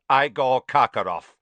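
a curt official calling the name; clipped, formal summons; stern magistrate opening the hearing. A narrator's reading. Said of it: "said a curt voice".

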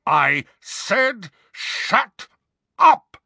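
slow, then very high and loud on up; man roaring at the portrait; furious roar. A narrator's reading. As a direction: roar the order over her screams. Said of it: "roared the man".

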